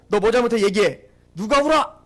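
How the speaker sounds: loud mock telling-off; forceful imitated scolding; acted parental scolding. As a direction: play a parent demanding an answer. Acts out parent scolding children.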